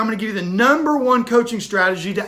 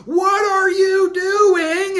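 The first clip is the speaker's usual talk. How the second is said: high, slow shout of shock; a panicked cry after being held under; gasping shout; 10 semitones higher, 3 dB louder, much slower than usual. Acted. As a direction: yell it as you burst up out of the water. Surfacing from water, shouting.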